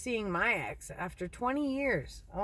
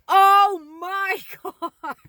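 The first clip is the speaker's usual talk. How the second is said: loud, high, drawn-out wail; hammed-up agonized outcry; reenacted pained shock; 10 semitones higher, 10 dB louder, much slower than usual. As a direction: cry out slowly as if bitten on the nose. Reenacting waking with ferret biting nose.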